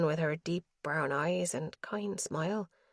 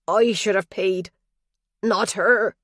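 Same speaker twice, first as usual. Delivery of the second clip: louder, lucid father confessing; absolute clarity; 2 semitones higher, 8 dB louder, slower than usual. A narrator's reading. Father to his daughter, a clear, louder confession. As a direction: say it clearly, each word deliberate. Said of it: "he said with absolute clarity".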